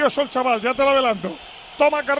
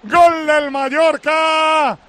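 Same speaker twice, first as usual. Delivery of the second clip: loud repeated goal cry, drawn out; goal shout; 3 dB louder, much slower than usual. Real, not acted. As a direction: scream the goals out loud. Goal scored, commentator calls.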